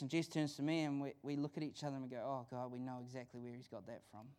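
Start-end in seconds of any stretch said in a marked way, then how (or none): 2.2-4.3 much lower and softer; rueful aside. Retold or imitated. Parent turns to spouse over their child, quietly rueful. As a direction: drop it to a knowing undertone.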